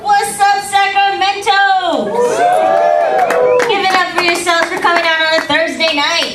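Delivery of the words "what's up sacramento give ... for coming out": calling out to the crowd. Real, not acted. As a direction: rev the crowd up, stretch out the city name. Comic greets the crowd.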